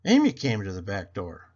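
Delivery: plain reading voice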